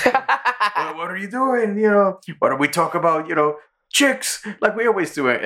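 a high, laughing plea to chat about chicks; Carl's voice. Retold or imitated. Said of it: "Carl's like".

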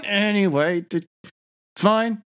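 tight, forced calm; strained fine. Real, not acted. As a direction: pretend it's fine through gritted teeth. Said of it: "I know it's not fine".

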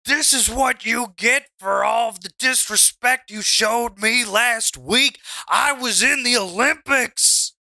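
high, indignant wrestler's tirade; mock shouting. Wrestler berating the man in his ankle lock, shouting. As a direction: shout it down at a beaten opponent. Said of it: "he's shouting at you".

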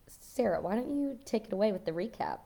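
ordinary talk, nothing marked

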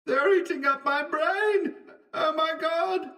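very high, distant wail of pain; agonized cry. A narrator's reading. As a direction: wail it thinly, as if from out in the fog. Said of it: "a voice in the last extremity of unutterable pain".

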